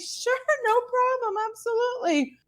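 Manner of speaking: high, overly sweet cover-up; fake breezy. Answering the swab guy, covering with high fake cheer. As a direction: sound fine while hiding a disaster.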